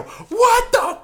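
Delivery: high, loud mock scream; acted outburst. Dreamer as his girlfriend dumps him, high retold scream. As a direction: blurt it as a re-enacted screech.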